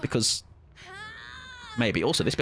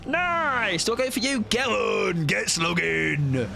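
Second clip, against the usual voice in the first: drawn-out, high silly chant; goofy elongated crowing; playful sing-song shouting; 5 semitones higher, slower than usual. Pretend. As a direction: stretch the vowels like a daft cheer. Silly chanting during gameplay.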